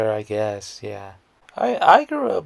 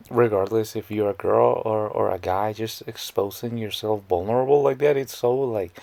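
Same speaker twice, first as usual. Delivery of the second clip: ordinary talk, nothing marked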